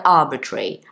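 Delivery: "arbitrary" run together as one quick word; said quickly. Shown as a demonstration. Teacher showing learners how it sounds at speed, quick connected demo. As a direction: say "arbitrary" briskly, ending like "tree". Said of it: "when you say it quickly".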